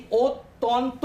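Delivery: অত্যন্ত said slowly, syllables apart; অত্যন্ত slow and segmented; slow syllable demo. Shown as a demonstration. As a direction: stretch অত্যন্ত out beat by beat. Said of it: "এইগুলোকে দল বলা হয়".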